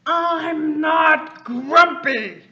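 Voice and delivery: slow, loud, high shout; grumpy yell. A narrator's reading. Father reading to child, slow loud monkey shout. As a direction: bellow it out as a furious monkey. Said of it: "he yells".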